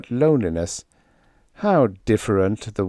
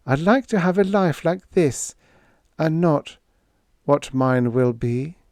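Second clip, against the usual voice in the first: wistful Lila; 7 semitones higher than usual. A narrator's reading. Lila tells Dale the life she wishes for, wistfully.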